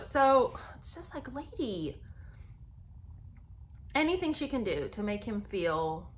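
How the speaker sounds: ordinary delivery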